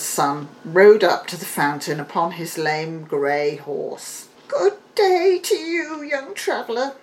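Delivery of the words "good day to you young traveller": put-on high, crackly old crone greeting; an old hag calling to a passer-by; high witchy beggar voice; old beggar woman. A narrator's reading. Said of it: "said the beggar woman".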